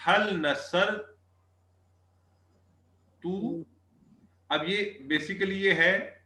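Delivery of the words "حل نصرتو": drawn-out reading. Shown as a demonstration. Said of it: "اب دیکھیں، آگے ہم نے پوچھا".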